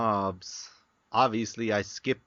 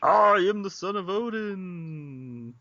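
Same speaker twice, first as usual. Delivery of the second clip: heroic Thor voice; 7 semitones higher than usual. Pretend. Thor impression.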